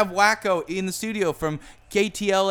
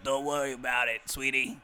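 visitor character; 3 semitones lower than usual. Acted. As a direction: lower put-on voice. Voices the guest at the door.

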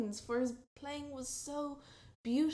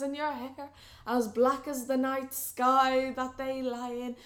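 loud grand wooing; 9 dB louder than usual. Acted. Buneri's loud rival wooing.

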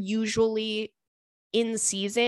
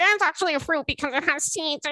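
quick, higher smug nitpicker voice; know-it-all correction; 7 semitones higher, faster than usual. Retold or imitated. Imitating someone correcting her about tomatoes, smug. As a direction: sneer out the correction like a pedant. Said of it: "somebody's like".

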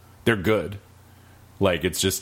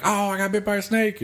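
high, plaintive mock complaint; whiny snake-bit stranger. Game stranger telling the player about his bite, high and plaintive. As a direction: play the pitiful bitten guy. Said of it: "he's like".

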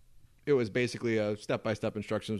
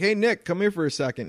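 imitating dad calling out, higher pitch; raised, high call summoning someone over; retold yell; 7 semitones higher, 4 dB louder than usual. Son retells dad calling him into the office, imitated yell. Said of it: "he always yells to me".

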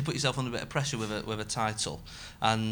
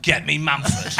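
mock demand; 3 semitones higher, 9 dB louder, slower than usual. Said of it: "no one went".

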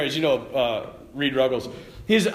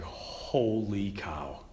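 awed holy cow; 9 semitones lower, 10 dB quieter, much slower than usual. Retold or imitated. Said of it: "I was like".

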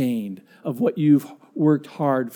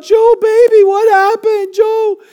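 very high, loud panicked cry; freaking out; 7 dB louder, slower than usual. Retold or imitated. Wife finding husband passed out, high panicked calls. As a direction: rush to him in alarm. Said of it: "She starts to freak out".

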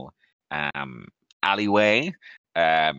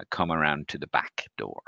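normal talking voice

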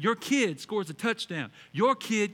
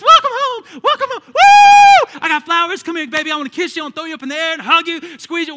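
shouted, much higher and louder welcome; joyful airport welcome; 10 semitones higher, 17 dB louder than usual. Retold or imitated. Pastor acting a family greeting someone at the airport, joyful shout. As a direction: greet your loved one with a joyful shout. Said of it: "with a sign that says".

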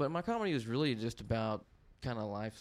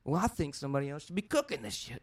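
higher, sarcastic snipe at the waitress; snarky comeback; 5 semitones higher than usual. Retold or imitated. Retorting to the waitress who says he burns the chicken, sarcastically. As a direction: snap back with mock indignation. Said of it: "something like that".

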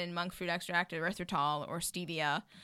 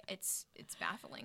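slow, whispered disbelief; baffled; 16 dB quieter, slower than usual. Real, not acted. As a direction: let it drop to a stunned whisper. Aghast at what people eat.

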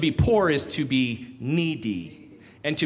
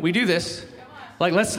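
normal talking voice

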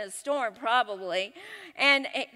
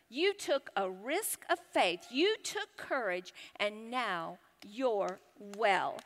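soft, slow, tender reassurance; reassuring; 5 semitones lower, 7 dB quieter, slower than usual. Acted. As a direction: let each phrase settle quietly, dropping low at the end. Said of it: "Then he reassured her".

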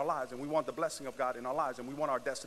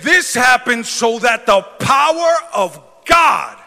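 slow, loud, higher preacher shout; shouted preaching; 8 semitones higher, 20 dB louder, slower than usual. Acted. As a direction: proclaim it to the whole church. Preaching climax.

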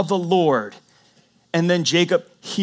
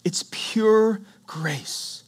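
slow breathy whisper of awe; whispered emphasis; 2 semitones higher, much slower than usual. Real, not acted. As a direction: let it fall to a hush, very slow. Sermon climax, whispered.

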